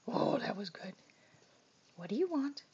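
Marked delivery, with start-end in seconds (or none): none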